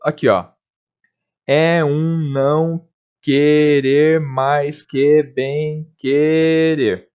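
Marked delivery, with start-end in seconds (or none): 1.5-7.1 "Amor é fogo que arde sem se ver" drawn out slowly, each syllable separated; scanning a line of verse for students; syllable-by-syllable scansion. Shown as a demonstration. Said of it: "Vamos pegar o, aqui, ó".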